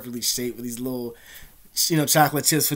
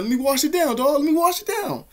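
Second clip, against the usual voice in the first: high, louder mimic of a moocher; pushy bully impression; 13 semitones higher, 4 dB louder than usual. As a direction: act out a bully begging for a swig. Bully grabbing a drink, acted.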